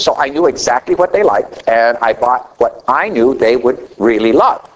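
ordinary talk

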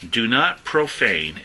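slow, with "not" and "profane" leaned on; preacher dwelling on "profane"; emphasis on profane. Shown as a demonstration. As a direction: press down on the word profane. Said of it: "I'm gonna be emphasizing the word profane".

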